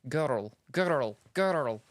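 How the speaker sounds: attempted Scottish "girl" with a rolled r; Scottish trilled girl. Shown as a demonstration. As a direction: stretch "girl" to "gir-rl", trilling it. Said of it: "like a trill type of thing".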